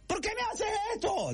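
very high, cracking mock wail; husband breaking down in a high falsetto wail. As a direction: squeal the question in a falsetto cry. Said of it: "Los gritos así".

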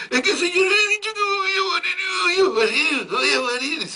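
comic high wailing shout; hammed-up crying shout. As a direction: howl it like a hysterical maid.

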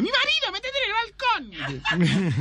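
panicked wife. Acted. Wife warns her lover that her husband is home, alarmed high squeal. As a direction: squeal it like a startled woman.

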